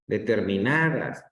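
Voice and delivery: "Determinadas" lento, con el acento normal en NA. Shown as a demonstration. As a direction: alarga la A de NA en "Determinadas". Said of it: "la sílaba que se escucha mejor es en na". ("Determinadas" con el golpe de voz en NA)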